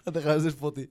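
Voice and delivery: laughing. Real, not acted. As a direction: say it through laughter. Laughing mock reply.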